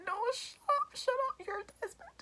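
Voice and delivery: tiny, very high mock whimper; hammed-up emotional pleading; tearful protest. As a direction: squeak it out as if overcome, fading away. Reliving tearful reply to the character.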